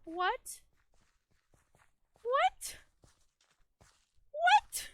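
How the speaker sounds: escalating stunned what; shocked disbelief. Real, not acted. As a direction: cry out in real shock as the game glitches. Fell through the map mid-game.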